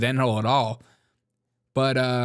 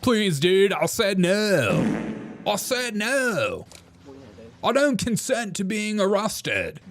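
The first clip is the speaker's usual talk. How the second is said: whiny pleading mimic; 7 semitones higher, slower than usual. Mimics the arrested man's pleading.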